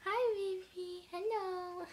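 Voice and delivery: soft, high cooing hello. Real, not acted. Owner greeting a guinea pig that wandered over, soft baby talk. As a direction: coo tenderly to a little animal.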